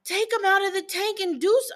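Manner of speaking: put-on high, whining child at her mother; whiny kid voice. Twelve-year-old pressuring mom about the dying fish, high whine.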